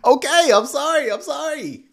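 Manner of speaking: high, timid mock apology; mock cowed apology. Commentator mockingly apologizing to the angry preacher, timid. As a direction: cower like a scolded child.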